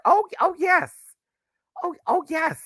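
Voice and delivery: high falsetto imitation of a character; put-on character voice. Acting out the repeated character.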